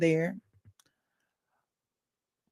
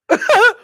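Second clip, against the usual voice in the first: laughter; 14 semitones higher, 11 dB louder than usual. Real, not acted. Laughing at quitting anyway.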